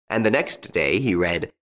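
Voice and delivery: usual speaking voice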